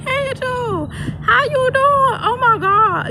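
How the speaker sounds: high-pitched imitation of a delighted friend; excited greeting. Teacher friend greeting her by chance, excitedly imitated. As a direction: call out high, thrilled to bump into her.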